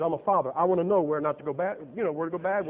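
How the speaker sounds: normal talking voice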